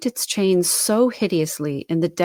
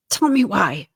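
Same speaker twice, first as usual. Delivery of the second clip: trembling; 4 semitones higher, 5 dB louder than usual. A narrator's reading.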